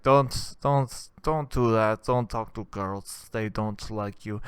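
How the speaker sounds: Gru voice. Retold or imitated. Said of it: "They all talk like, uh, Despicable Me guy".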